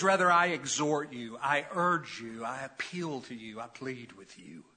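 gentle pleading. Acted. Preacher voicing Paul pleading with his brothers, gentle plea. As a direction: ease down word by word into a gentle plea. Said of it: "there's a gentle touch here with Paul".

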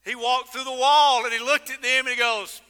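loud, rising preacher's build; preaching at full force. Acted. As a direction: build the story to a peak.

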